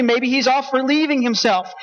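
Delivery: ordinary talk, nothing marked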